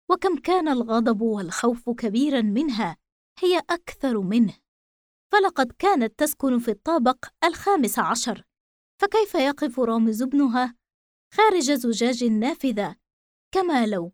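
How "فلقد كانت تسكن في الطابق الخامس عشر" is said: usual speaking voice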